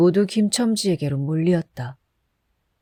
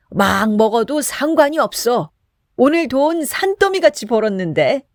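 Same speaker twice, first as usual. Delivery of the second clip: put-on drunken bluster; 5 semitones higher than usual. A narrator's reading. Kim yells at Chisam to keep pouring, drunk and boastful. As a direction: bluster like a drunk who just got rich.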